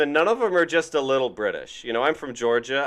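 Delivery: ordinary talk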